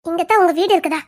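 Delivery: ordinary talk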